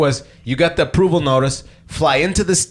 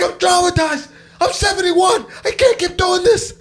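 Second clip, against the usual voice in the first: put-on hysterical old man, pitched way up; high, strained mock shouting; imitated yelling man. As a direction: mimic a distraught traveller's outburst. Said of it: "there's an old man yelling".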